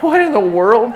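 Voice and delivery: high, loud, startled question; startled disbelief. Retold or imitated. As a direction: blurt it out in alarmed disbelief. Startled at friend in danger.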